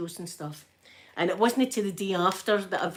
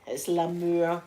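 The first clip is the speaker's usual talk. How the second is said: usual speaking voice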